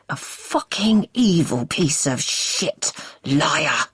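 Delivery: slow, strangled anger; strangled fury. Acted. Husband accusing his wife, slow strangled fury. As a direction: force the words out slowly through rage.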